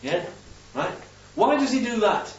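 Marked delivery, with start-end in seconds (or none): none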